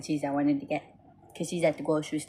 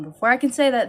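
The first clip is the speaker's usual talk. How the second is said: normal talking voice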